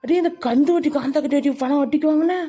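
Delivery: high-pitched, appalled question; shocked scolding. Acted. Friend appalled at the loan. (அதிர்ச்சியுடன் திட்டும் குரல்)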